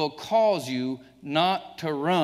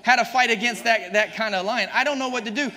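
loud, higher fiery preaching; full force preaching; 7 semitones higher, 6 dB louder than usual. Acted. Preacher exhorting the congregation at full force. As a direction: thunder the verses at the church.

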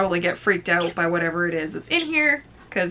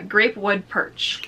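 normal talking voice

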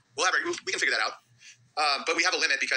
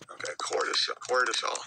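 whisper; 7 semitones lower, 5 dB quieter, slower than usual. Real, not acted. Reading name under breath.

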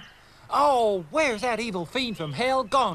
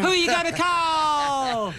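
drawn-out, raised shout; catchphrase holler; 4 semitones higher, 3 dB louder, slower than usual. Acted. Kid geared up to hunt spooks, hollered catchphrase. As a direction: holler it like a rallying cry. Mimics a film catchphrase, excited.

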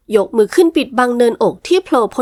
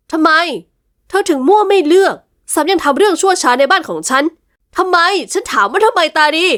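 angry demand; 6 semitones higher than usual. Acted. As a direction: hammer the why at her. Master's jealous outburst. (นายหัวตวาดถามตารีด้วยความโกรธ)

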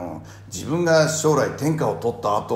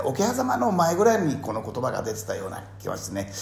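ordinary talk, nothing marked

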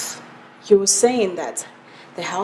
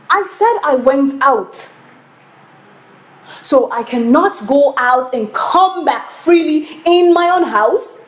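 raised, slow, emphatic retort; indignant protest; 5 semitones higher, 5 dB louder than usual. Acted. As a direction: push back loudly, stretching the words. Wife protests at being questioned.

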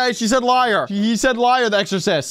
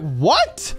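normal talking voice

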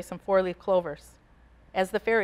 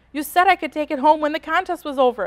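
put-on higher, louder child's protest; upset cry; 7 semitones higher, 5 dB louder than usual. A narrator's reading. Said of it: "Elise cried".

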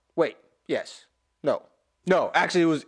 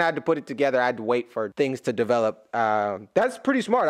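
ordinary talk, nothing marked

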